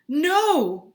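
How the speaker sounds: loud, high anguished cry. Acted. Henry crying out at news of his family. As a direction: cry out in despair. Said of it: "cried Henry".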